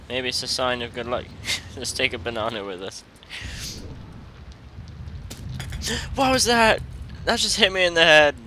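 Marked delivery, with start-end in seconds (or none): 3.3-6.1 high breathless gasp of fright; sharp gasp. Real, not acted. Sudden scare in game. 6.1-8.3 high, loud startled cry; shocked outburst. Real, not acted. Hit by a flying plate.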